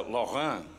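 "Laurent" said slowly the French way; French-style "Laurent" with a nasal ending and no t; French pronunciation. Shown as a demonstration. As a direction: say "Laurent" as a French name, final t silent. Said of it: "It's French".

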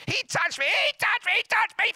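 very high, loud tattling whine; squabbling kids. Acted. Children fighting in the back seat, shrill squabbling. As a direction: shriek it like a child telling on a sibling. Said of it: "They're fighting on the way to church".

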